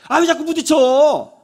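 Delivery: loud, high exasperated snap; irritated yell. Acted. Husband snaps at his wife who bumped herself, loud and high. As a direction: bark at her for being clumsy.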